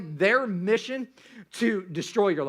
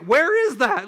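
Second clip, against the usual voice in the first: put-on scared child asking; 5 semitones higher, 7 dB louder, slower than usual. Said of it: "We were actually asking where that joy".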